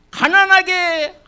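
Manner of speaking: 가난하게 said high, loud and slow; stressed word. Acted. Pastor to congregation, hitting 가난하게 high and slow. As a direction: lean hard on 가난하게.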